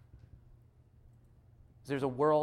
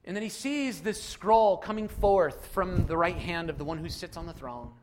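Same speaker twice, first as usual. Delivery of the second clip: usual speaking voice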